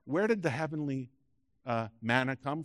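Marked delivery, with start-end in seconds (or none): none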